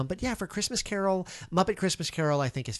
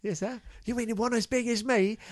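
Cockney urchin voice, raised pitch; old london cockney; 9 semitones higher than usual. Pretend. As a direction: play a cheeky London street kid. Echoing the urchin, Cockney style.

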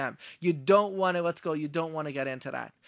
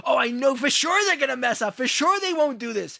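loud, high, dead-certain pessimism; emphatic doubting; 9 semitones higher, 8 dB louder than usual. Acted. A doubter predicting failure out loud, emphatic and dismissive. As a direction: write them off loudly and high.

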